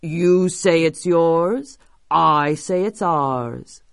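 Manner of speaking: slow, drawn-out big creature; monster voice. Acted. Biggest monster disputing the house, slow creature voice. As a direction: stretch it out like a monster staking a claim. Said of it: "said the biggest monster".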